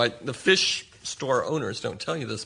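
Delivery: ordinary delivery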